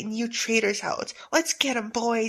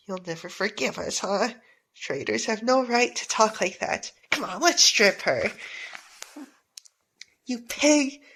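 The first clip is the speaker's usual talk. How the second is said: usual speaking voice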